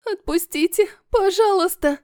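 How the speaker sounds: put-on high, frightened begging girl; trembling plea. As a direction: plead in a high scared voice. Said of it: "дрожит Ася".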